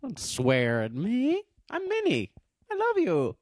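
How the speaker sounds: bad put-on accent, leaping very high; hammed-up woman's voice with a terrible accent; Minnie. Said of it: "That's terrible accent".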